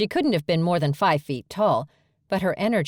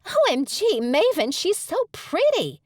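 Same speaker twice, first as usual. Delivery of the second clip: much higher, gushing excited pixie squeal; high-pitched energetic. Acted. Pixie twin gushing to Maven about Bailey, high-pitched. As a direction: bubble over with delight. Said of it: "A high-pitched, energetic voice".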